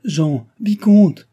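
high, loud, drawn-out call; crying out. A narrator's reading. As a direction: call slowly at full voice. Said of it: "criait mademoiselle Chon".

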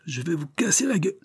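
high-pitched threat, shouted without real volume; strained, high mock yell; howled threat. A narrator's reading. Boss threatening his three men, howled. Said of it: "hurla Pied-de-Bœuf".

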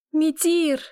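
high loud cry of Митир; shouting. A narrator's reading. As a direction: scream Митир in despair. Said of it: "уже кричу".

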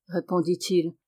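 plain reading voice

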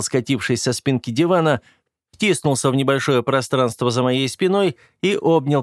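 plain reading voice

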